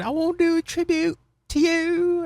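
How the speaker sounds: slow, very high falsetto imitation; Leon impression. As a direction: draw it out in a squeaky mock voice. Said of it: "that was my Leon voice".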